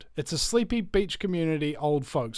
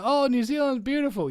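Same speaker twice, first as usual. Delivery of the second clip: gushing admirer; 8 semitones higher than usual. Retold or imitated. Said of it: "People go".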